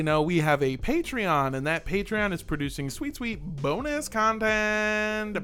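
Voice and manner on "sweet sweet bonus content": slow, savoring hype; drawn out. Acted. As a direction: stretch it out, teasing. Hyping the Patreon bonus.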